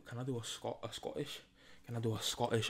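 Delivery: usual speaking voice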